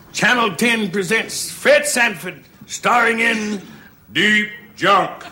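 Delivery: slow, grand mock announcement; mock TV announcer. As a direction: announce the film title with deliberate pauses. Imagines the film's television announcement.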